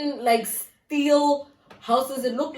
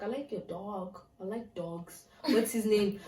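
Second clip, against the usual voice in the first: much softer, nervous small talk; sheepish and quiet; 3 semitones lower, 10 dB quieter than usual. Retold or imitated. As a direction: ease the tension, barely above a murmur. Nervously changing subject with guard.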